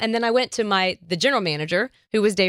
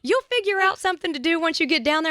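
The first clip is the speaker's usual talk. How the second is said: upbeat mentor; 9 semitones higher than usual. Retold or imitated. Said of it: "He goes".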